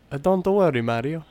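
high, cartoon Italian plumber voice; Luigi. Luigi reassuring Mario before the race, cartoon Italian accent. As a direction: reassure a brother in a playful Italian lilt. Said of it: "And Luigi's like".

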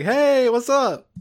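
high, slow, overly friendly hello; cheery greeting voice. Retold or imitated. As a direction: stretch it out like a fake friend. Sagawa greets Majima, retold scene.

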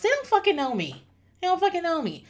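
high-pitched, falling exasperated protest; exasperated insistence. Real, not acted. Insists coworkers don't know her.

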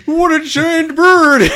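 very high, very loud, slow mock Swedish chef shriek; Swedish chef freakout. Swedish chef spots Big Bird, shrieking Swedish chef accent. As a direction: shriek it like the chef losing his mind. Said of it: "Swedish chef loses his mind".

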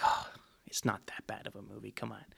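soft whispered aside; whispering. Retold or imitated. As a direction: whisper it to yourself. Retelling own reaction to the movie.

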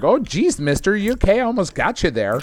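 a hint of Minnesota nice in a driver's apology; Minnesota accent. Driver asking the man he nearly hit, light Minnesota accent. Driver checks on near-miss pedestrian.

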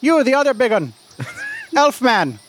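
high, lilting mock Irish brogue; brisk Irish leprechaun. Leprechaun asking adventurers what fae they want, in mock Irish. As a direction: play a cheeky leprechaun in fake Irish. Game master plays leprechaun.

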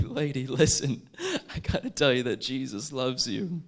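fervent retold street preaching, drawn out and loud; urgent proclamation. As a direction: proclaim it forcefully to someone who must hear it. Urgently evangelising a stranger, retold.

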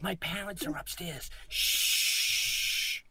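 put-on high hushed teen voice; mock sneaky teenager. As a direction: play the sneaky high schooler. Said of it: "It's like being in high school".

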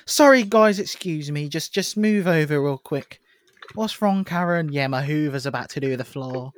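high, smug show-off voice; show-off party host. Acted. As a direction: brag like a posh host. Acted show-off at house party.

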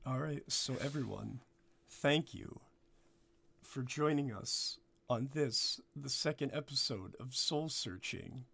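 low, quiet sign-off; outro sign-off. Acted. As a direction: wind it down softly. Wraps up the episode.

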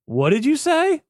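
can't believe what they just heard; mock outraged disbelief; incredulous what. Stunned couple's disbelief.

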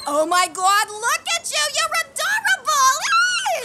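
high, loud gushing delight; gushing excitement. Acted. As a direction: coo over the cute clowns. Mom greeting the clowns.

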